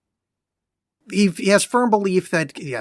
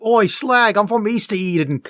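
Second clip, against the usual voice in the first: Cockney; 4 semitones higher than usual. Retold or imitated. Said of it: "Cockney parody of movie accent".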